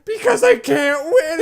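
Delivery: loud, high, drawn-out wail, imitated; hammed-up tearful shout; mock crying outburst. Retelling her tears after losing the card game, mock wailing. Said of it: "she just starts fucking crying".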